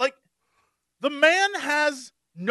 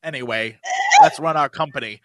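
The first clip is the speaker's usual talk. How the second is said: mock fatherly voice; 11 semitones lower than usual. Mocking the show's ending to friends, low and smug. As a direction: parody a smug reconciliation. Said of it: "the end of the show was like".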